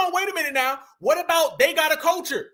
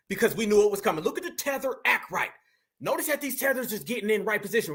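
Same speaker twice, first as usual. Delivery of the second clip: usual speaking voice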